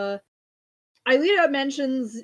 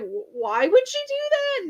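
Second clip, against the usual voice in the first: much higher, startled question; shocked; 14 semitones higher, 4 dB louder than usual. Retold or imitated. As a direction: jolt up in alarm at the news. Jeremy hears shocking news.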